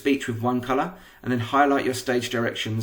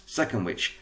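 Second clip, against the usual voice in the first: usual speaking voice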